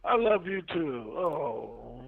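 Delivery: ordinary talk, nothing marked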